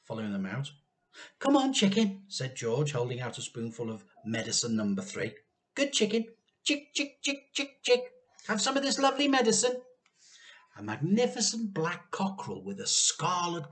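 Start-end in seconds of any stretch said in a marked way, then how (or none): 1.4-2.2 high coaxing call. A narrator's reading. George coaxing the chicken, high coaxing call. 5.8-9.8 coaxing call. A narrator's reading. George tempting the chicken, high coaxing call.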